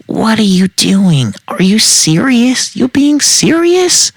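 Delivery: high, incredulous yell climbing to a screech. A narrator's reading. Woman following the man walking away, yelling.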